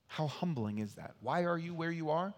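ordinary talk, nothing marked

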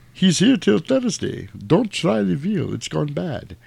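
high, slow mock comedian patter; stand-up sign-off. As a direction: ham up a lounge comic's closing line.

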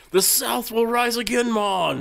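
a playful mock Jamaican lilt; hammed-up Jamaican accent. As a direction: toss it off in a joking Jamaican accent. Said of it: "Sounds a lot like a Jamaican".

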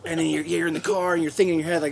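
ordinary delivery

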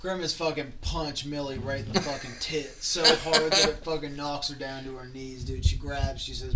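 ordinary delivery

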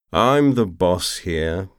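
slow, loud lazy drawl. A narrator's reading. Anatin claims command, drunk and drawling. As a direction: stretch it out, drunk and smug. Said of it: "Anatin drawled".

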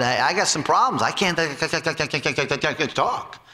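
hammed-up stutter trailing off; mock stutter. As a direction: act out Moses stuttering, fading to a mumble. Moses stuttering in retold complaint.

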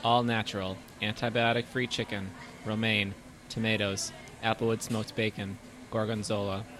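hushed reading. Acted. Hushed reading of menu item.

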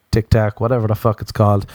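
ordinary talk, nothing marked

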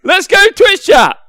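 high, loud and slow cheer; excited yell with a laugh; laughing hype shout. Real, not acted. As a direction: whoop at the chat in delight. Thinks FAQ is live.

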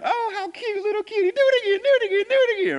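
very high, loud, taunting hyena voice; mocking hyenas. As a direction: tease the little cub like a cackling hyena. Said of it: "they just start to laugh".

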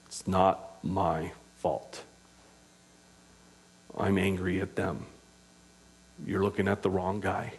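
understated mock blame-shifting, kept low and soft; quiet self-excusing. Angry person blaming someone else, quiet self-excusing.

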